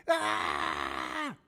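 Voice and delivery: long, strained scream; hammed-up scream of panic; mock scream. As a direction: scream as if the plane is going down. Acts out screaming on crashing plane.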